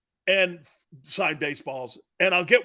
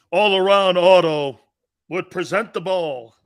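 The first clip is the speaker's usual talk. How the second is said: announcer read; 7 dB louder than usual. Pretend. Sponsor announcement.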